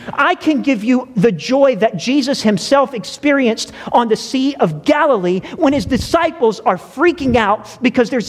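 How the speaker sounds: rising preacher's voice, slowing on the sleeping Jesus; building sermon. Acted.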